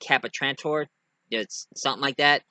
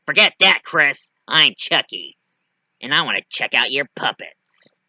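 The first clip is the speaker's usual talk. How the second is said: loud, forceful drop out of the doll voice; evil doll dropping the cute act; Chucky; 5 dB louder than usual. Acted. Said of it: "Chucky showed up".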